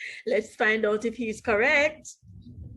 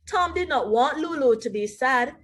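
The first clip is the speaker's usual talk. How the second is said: plain reading voice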